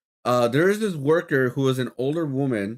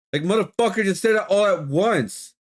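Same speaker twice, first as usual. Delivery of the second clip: pitched up, exasperated outburst; exasperated rant; 8 semitones higher than usual. Real, not acted. Ranting about an annoying McDonald's customer, exasperated and pitched up. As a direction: vent your disbelief at the rude customer.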